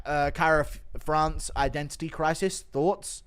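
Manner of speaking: normal talking voice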